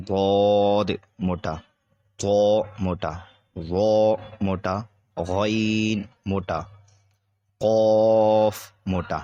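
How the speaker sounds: ضاد، طا، ظا، غین، قاف each said slow and heavy; teacher drilling the heavy letters. Shown as a demonstration.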